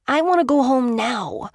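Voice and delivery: put-on high, whiny small boy wanting to leave; a little boy sulking at his grandmother; pouting little boy. A narrator's reading. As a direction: pout it like a bored child. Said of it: "he pouted".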